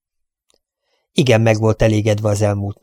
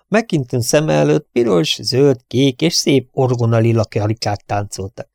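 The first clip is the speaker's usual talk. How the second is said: ordinary delivery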